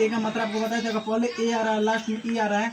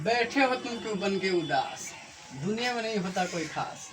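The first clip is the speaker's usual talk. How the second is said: motivational poem recital; 3 semitones lower, 4 dB quieter than usual. Acted. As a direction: recite it like a short inspiring verse.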